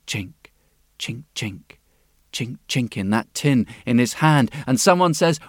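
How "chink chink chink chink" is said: soft chinking sound. A narrator's reading.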